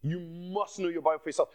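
normal talking voice

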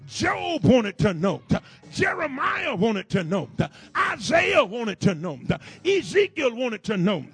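rising, shouted preacher cadence; preaching at full force. Acted. Preacher whooping to the church, loud and rhythmic. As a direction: whoop it to the congregation.

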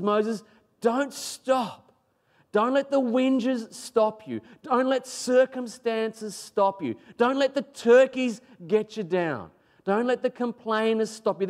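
high, slow, emphatic urging; urgent exhortation. Acted. Preacher as God urging Moses onward, emphatic exhortation. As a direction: drive each command home, pitch held high.

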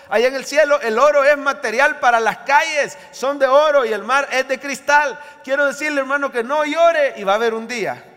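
high, loud pulpit proclamation; impassioned sermon at full volume; full-force preaching. Real, not acted. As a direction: proclaim it forcefully to the whole congregation.

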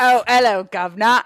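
a hammed-up cockney greeting; chirpy put-on London accent; mock cockney. Friends riffing on diphtheria, mock cockney greeting.

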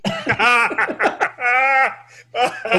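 loud, high burst of laughter; big high laugh. Real, not acted. Storyteller cracking up at the toupee joke, loud laugh. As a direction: laugh hard at the punchline.

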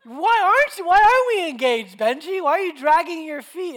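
loud, high-pitched imitation of an angry girlfriend; upset and angry. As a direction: wail the complaint at full volume, hammed-up. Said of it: "she's upset and sad and angry".

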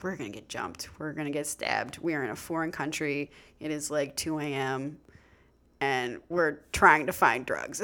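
low, hushed, deadpan dread; low worried aside. Retold or imitated. Woman fearing the questionable men, low hushed dread. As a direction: keep it down low and ominous, spelling out the danger.

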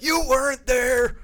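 high, loud, drawn-out joke yell; hammed-up tearful outburst at a spouse; mock drunken yelling. Drunk husband yelling at his wife, hammed-up shout. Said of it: "end up in tears yelling at my wife".

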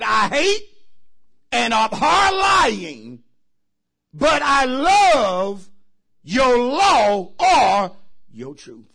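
slow, loud, high shouted scripture; preaching at full force. Acted. Preacher reading the psalm, full-force shouting. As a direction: drag each word out in a roar.

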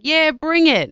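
high, loud cheer; coşkulu tezahürat. Retold or imitated. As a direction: whoop it out eagerly. Enthusiastic shout for East Coast.

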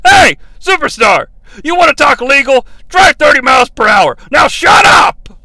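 loud, high mocking shout; mock Bobby Heenan. The Brain shouting down Superstar Graham, loud and high. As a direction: bark it like a smug heel commentator. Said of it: "The brain chimes in".

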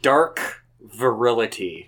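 low, slow deadpan echo; deadpan mocking repeat. Repeating phrase in low mockery.

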